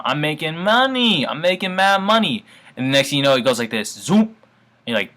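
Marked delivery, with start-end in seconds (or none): 0.0-1.2 excited. Pretend. Imagined trader watching profits. 1.2-2.4 gleeful. Pretend. Imagined trader, gleeful escalation.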